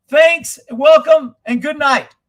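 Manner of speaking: high, loud mock speech opener; mocking a speechmaker. Streamer mocking a politician's opening, high and loud. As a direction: ham up the clueless politician. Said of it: "That dumbass is starting off his speech".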